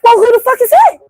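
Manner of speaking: baffled friend snapping back; incredulous retort. Retold or imitated. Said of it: "I'm like".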